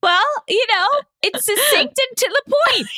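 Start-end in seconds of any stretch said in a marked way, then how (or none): 1.2-3.0 high, giggling through the words; laughing. Real, not acted. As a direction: speak while laughing hard. Laughing while joking.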